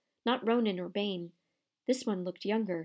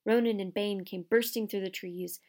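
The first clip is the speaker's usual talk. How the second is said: plain reading voice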